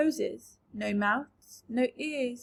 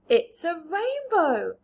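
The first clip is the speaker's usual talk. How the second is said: excited cry; 5 semitones higher, 7 dB louder, slower than usual. A narrator's reading. Everyone exclaims at the rainbow.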